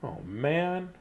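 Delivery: ordinary delivery, nothing marked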